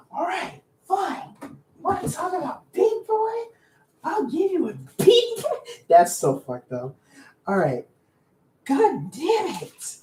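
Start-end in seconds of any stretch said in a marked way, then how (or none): 0.1-5.6 very high, squeaky mock tantrum; exasperated giving in. Streamer giving in to viewers' demand, mock-petulant squeal. 8.6-10.0 slow, high-pitched cursing; frustrated curse. Real, not acted. Streamer hunting for a requested character, frustrated.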